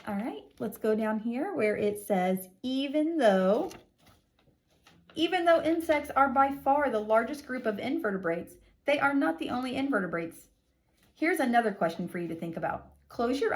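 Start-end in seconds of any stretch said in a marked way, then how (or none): 2.6-10.5 higher, louder storybook read; read-aloud. Acted. Teacher reading the textbook aloud to young pupils. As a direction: read it out brightly to children.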